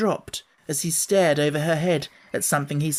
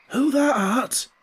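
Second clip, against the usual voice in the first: put-on high, slow trembling old voice; shaky voice; 9 semitones higher, slower than usual. A narrator's reading. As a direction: let the old voice quaver. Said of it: "in a queer, shaky voice".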